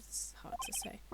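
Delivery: hushed whispered aside. Real, not acted. Player muttering an aside, whispered. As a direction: breathe it out with no voice. Aside while reading game text.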